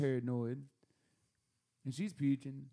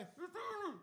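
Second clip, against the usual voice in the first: high, softer voice from outside; muffled visitor voice; 7 dB quieter, slower than usual. Retold or imitated. Visitor answering through the door, high distant-sounding call. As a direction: imitate someone calling high from behind a door.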